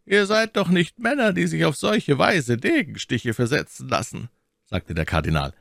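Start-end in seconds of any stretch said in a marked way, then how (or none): 0.1-4.3 high-pitched, lightly reproving man of power; reproving cardinal. A narrator's reading. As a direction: chide the men from above. Voices the cardinal reproving musketeers.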